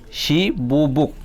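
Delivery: "Shibu buk" slowed, shi held apart from bu buk; syllable by syllable. Shown as a demonstration. Teacher asking how many syllables, "Shibu buk" slowed down. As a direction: say "Shibu buk" slowly, sounding each syllable. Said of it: "kaibaso kokthor tong".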